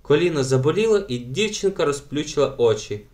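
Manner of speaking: ordinary delivery, nothing marked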